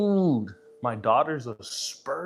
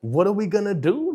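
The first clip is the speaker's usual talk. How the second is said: exasperated; 7 semitones higher, 7 dB louder than usual. Retold or imitated. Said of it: "I'm like".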